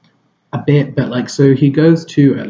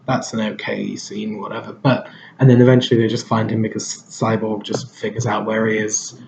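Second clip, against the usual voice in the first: usual speaking voice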